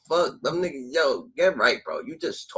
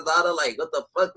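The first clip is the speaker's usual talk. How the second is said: normal talking voice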